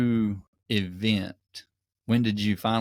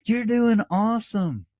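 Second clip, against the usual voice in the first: much higher, bright cheering call; cheery encouragement; 13 semitones higher, 4 dB louder than usual. Retold or imitated. Leader cheering other ultra runners on a loop course. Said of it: "they'll just be like".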